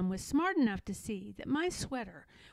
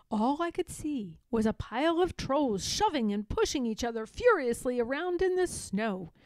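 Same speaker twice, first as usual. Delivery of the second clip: ordinary delivery, nothing marked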